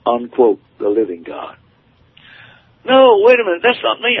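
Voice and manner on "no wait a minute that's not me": high, louder panicked protest; desperate cry. Retold or imitated. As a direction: plead in alarm, voice shooting up. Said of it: "I cried".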